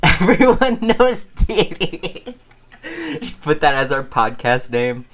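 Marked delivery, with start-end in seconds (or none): 0.0-2.3 high, slow, giggled proclamation; laughing catchphrase. Real, not acted. Joking about a podcast name, laughing out the catchphrase. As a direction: laugh through the quote.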